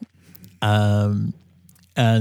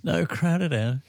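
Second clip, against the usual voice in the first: put-on higher Cockney accent, softer; 6 semitones higher, 4 dB quieter, slower than usual. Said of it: "doing that in a Cockney accent".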